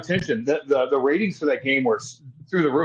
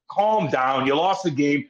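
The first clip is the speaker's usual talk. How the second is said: ordinary delivery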